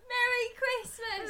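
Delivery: high, drawn-out mock Cockney impression; exaggerated London accent, played for laughs; Michael Caine. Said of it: "it's Michael Caine, so it's more like".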